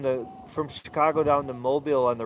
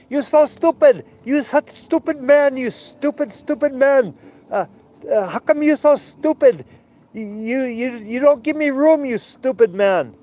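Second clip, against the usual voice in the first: put-on much higher, loud, furious broken-English rant, Hebrew accent; yelling Hebrew-accented crewmate; 11 semitones higher, 8 dB louder than usual. Israeli crewmate yelling at barge captains over the radio. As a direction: imitate an angry Israeli sailor yelling at a barge. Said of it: "he'd start yelling at him".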